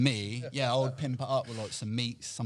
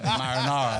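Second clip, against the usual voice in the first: loud correcting Marinara; mate's correction; 4 semitones higher, 10 dB louder than usual. Retold or imitated. Mate at Subway corrects him, loudly saying Marinara. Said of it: "He's like".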